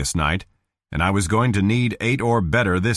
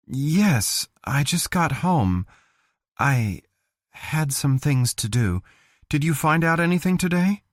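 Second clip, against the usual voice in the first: lightly voiced woman, higher, a little tired and guarded; tired and wary; 7 semitones higher than usual. Cheryl on the phone with Bill, tired and wary. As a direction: answer the phone warily, worn out. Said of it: "sounding tired and wary".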